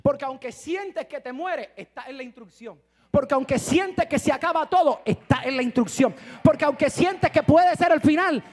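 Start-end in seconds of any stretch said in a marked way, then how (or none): 3.1-8.4 louder and louder, ending in a shout; building sermon climax; fiery preaching. Acted. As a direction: drive it home from the pulpit. Preaching at full force.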